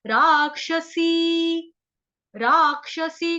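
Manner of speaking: राक्षसी with long रा and क्ष, drawn out; slow pronunciation. Shown as a demonstration. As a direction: stretch राक्षसी, lean on क्ष. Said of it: "क्ष जो है संयुक्त अक्षर है". (राक्षसी धीरे, लंबा रा)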